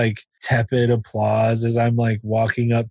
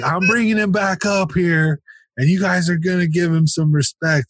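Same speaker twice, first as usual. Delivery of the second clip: insistent scolding; 7 semitones higher than usual. Retold or imitated. Host insists to booing audience.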